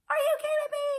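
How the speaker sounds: very high, squeaky incredulous question; high-pitched, exasperated squeal; outraged disbelief. Real, not acted. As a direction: let the pitch shoot up in disbelief. Outraged reaction to police leaving.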